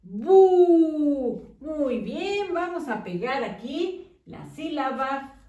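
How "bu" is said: loud, drawn-out "Bu" for the class to echo; stretched, loud "Bu" for pupils; syllable call. Shown as a demonstration. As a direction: call out "Bu" as a model for children. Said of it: "¿Cómo dice?".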